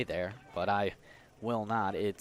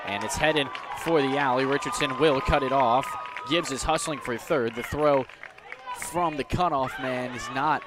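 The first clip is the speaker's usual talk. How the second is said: excited call; 3 semitones higher, 4 dB louder than usual. Real, not acted. Big hit, runner racing to third.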